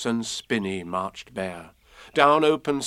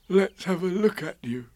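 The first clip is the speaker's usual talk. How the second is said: higher, kindly bear voice; Pooh; 6 semitones higher than usual. A narrator's reading. Pooh offering to inspect Eeyore, concerned. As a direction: look your friend over, lightly. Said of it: "said Pooh".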